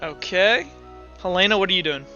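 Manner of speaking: ordinary talk, nothing marked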